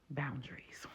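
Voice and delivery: soft, secret-like whisper of the answer. Shown as a demonstration. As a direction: breathe the key word quietly.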